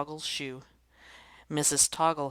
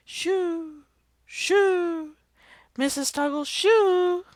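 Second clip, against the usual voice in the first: much higher, much louder sing-song call; 9 dB louder, much slower than usual. Acted. Children call the shoe down from the tree, loudly. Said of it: "Everybody called".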